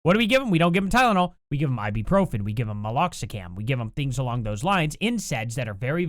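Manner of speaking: ordinary talk, nothing marked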